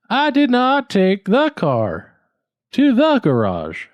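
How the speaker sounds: "did" pitched very high and falling. Shown as a demonstration. Student exaggerates the stress. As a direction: spike the word "did". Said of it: "or should it be".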